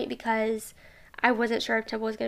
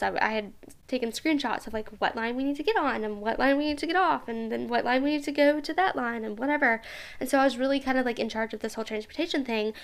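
normal talking voice

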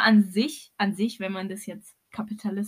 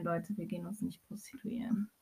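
whispered aside; 3 semitones lower, 14 dB quieter than usual. Acted. Addressing listeners jokingly.

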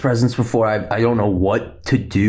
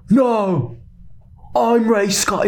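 high mock cry of denial; Rey; 11 semitones higher, much slower than usual. Rey denying it to Palpatine, high mock protest. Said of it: "And she's like".

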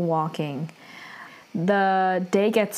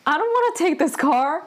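high, whiny mock complaint; disgusted refusal; 8 semitones higher than usual. Heroine refusing the old car, mock disgusted. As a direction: voice her disgust at the car, pitched up.

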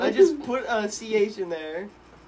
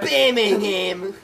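drawn-out Birmingham in a hammed-up British accent; mock British accent; 3 semitones higher, 10 dB louder, much slower than usual. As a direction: say Birmingham slowly, like a fake Brit. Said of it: "That's my British accent".

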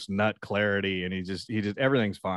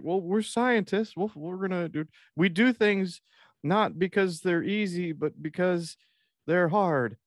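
high, halting dorky voice; pitched-up, slow and insistent; earnest scientist; 9 semitones higher than usual. Acted. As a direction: play the meek employee improvising a grand line. Scott explains himself to president.

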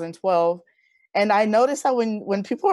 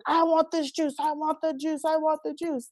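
held-back imitation of yelling, pitched way up; mock yelling; 9 semitones higher, 3 dB quieter than usual. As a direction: echo a child's insistent demand in a high voice. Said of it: "So I'm yelling at her".

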